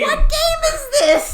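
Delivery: high, loud, slow, cracking with laughter; howling at the absurd story; incredulous laughing yell; laughing shout. Real, not acted.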